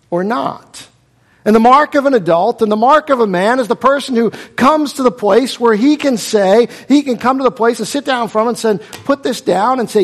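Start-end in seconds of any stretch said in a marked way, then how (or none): 1.4-10.0 loud, high preacher push; full-force preaching. Acted. Preacher urging the men in his congregation, louder and higher. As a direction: drive the point home from the pulpit.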